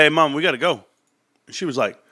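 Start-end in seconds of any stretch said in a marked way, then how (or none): 0.0-0.8 higher, louder urgent call to leave. Retold or imitated. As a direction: hurry her out of the club. Urging wife out of nightclub.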